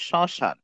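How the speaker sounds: শ্মশান with silent ম, nasal vowel; nasalised pronunciation. Shown as a demonstration. Teacher showing learners how শ্মশান is said, nasalised pronunciation. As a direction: say শ্মশান as "shɔ̃shan". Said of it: "শ্মশানের উচ্চারণ হবে".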